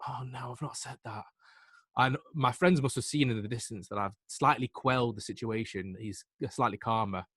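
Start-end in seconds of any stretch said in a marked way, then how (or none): 0.1-1.2 very soft retold aside of regret; quiet cringe at your own words; inward dismay. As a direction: say it under your breath, mock dismayed. Replays his own inward dismay.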